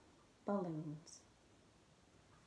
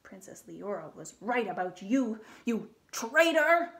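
thundering; 6 dB louder than usual. Acted. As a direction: thunder the accusation. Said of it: "the king thundered".

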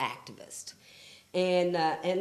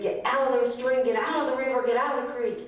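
higher, urgent mock yell; retold scream; 6 semitones higher, 4 dB louder, faster than usual. Grandmother ordering grandson out of the stream, urgent retold yell. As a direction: re-enact a scream without full volume, pitched up. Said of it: "And then I screamed".

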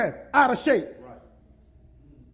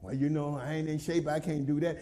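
low, soft lazy excuse, much lower than his own voice; a low mumbled mock excuse from the out-of-shape churchgoer; whiny excuse; 9 semitones lower, 9 dB quieter than usual. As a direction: mumble it like someone dodging the walk. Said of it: "hit the street to walk".

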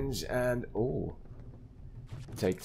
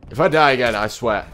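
louder, exasperated threat; annoyed outburst; 3 semitones higher, 10 dB louder than usual. Real, not acted. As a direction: vent real frustration at the game. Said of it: "I'm getting annoyed".

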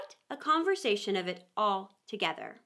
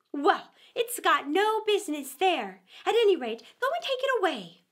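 high, louder scornful repeat; angry rabbit; 6 semitones higher, 4 dB louder than usual. A narrator's reading. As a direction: throw his words back at him, annoyed. Rabbit orders Pat.

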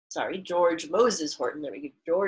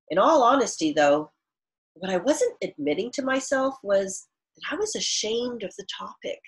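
louder, clear read-aloud for the class; reading aloud to children; 6 semitones higher, 7 dB louder than usual. Acted. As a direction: read the author's words to students. Teacher reads author's note aloud.

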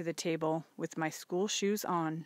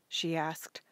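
usual speaking voice

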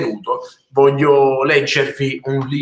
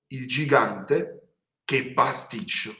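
ordinary delivery, nothing marked

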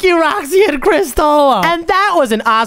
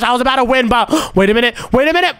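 ordinary delivery

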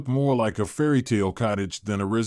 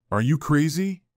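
understated shout, pitched higher; higher-pitched exclamation, only lightly shouted; mild shout; 4 semitones higher than usual. A narrator's reading. Monica to the dog under her wheels, mildly shouting. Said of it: "Monica shouted".

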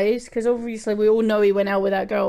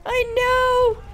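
excited; 12 semitones higher than usual. Real, not acted. Excited agreement with chat.

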